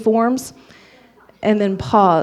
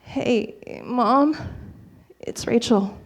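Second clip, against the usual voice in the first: small, soft, hesitant hello; tentative, halting phone greeting; nervous croak; 7 dB quieter, slower than usual. Acted. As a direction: croak it out timidly through a dry throat. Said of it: "I managed to croak out".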